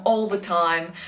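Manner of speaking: ordinary talk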